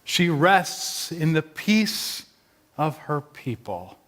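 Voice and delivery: slow, measured words of a rabbi; rabbi's pronouncement. Acted. Rabbi speaking over Matthew's buried mother, slow pronouncement. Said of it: "the rabbi said".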